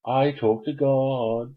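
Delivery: slow, sing-song smug boast; smug gloat. Pretend. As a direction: gloat at the audience slowly.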